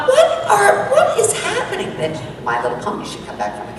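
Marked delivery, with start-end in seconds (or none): 0.1-1.9 high, baffled exclamation; mock astonished what-is-happening; bewildered. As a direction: squeal in disbelief. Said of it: "I go".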